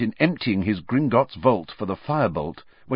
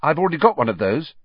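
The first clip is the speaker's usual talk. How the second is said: hurried, higher and louder; quick; 6 semitones higher, 5 dB louder, much faster than usual. A narrator's reading. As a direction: get it out fast before it's too late. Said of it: "said Harry quickly".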